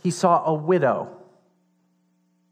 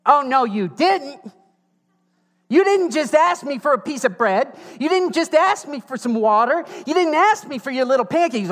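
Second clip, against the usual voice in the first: sassy oh no you didn't; 12 semitones higher, 7 dB louder than usual. Retold or imitated. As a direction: throw pure attitude at him, high and loud. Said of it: "this is what that sounds like".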